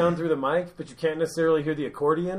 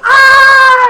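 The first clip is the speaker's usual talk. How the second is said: a sudden furious scream, much higher and louder; angry roar; 19 dB louder than usual. Retold or imitated. Comic storming off lets out a furious scream. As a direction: let out a raging yell. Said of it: "and he goes".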